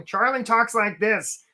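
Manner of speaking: goofy, stretched-out cartoon delivery; Charlie the crab. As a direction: put on a drawled, silly crab voice. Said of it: "I don't know why Charlie talks like that".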